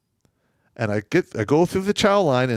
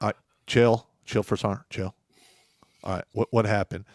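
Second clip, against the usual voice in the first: low, soft, settling voice; calming down; 5 semitones lower, 5 dB quieter than usual. Retold or imitated. Colonel to angry first sergeant, low and calming. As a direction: play the colonel talking down a furious man.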